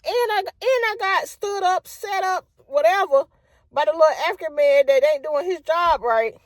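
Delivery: high, indignant rant; mock outraged tirade at the company; high furious complaint; ranting to them.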